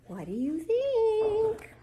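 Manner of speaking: plain reading voice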